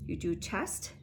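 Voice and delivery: ordinary delivery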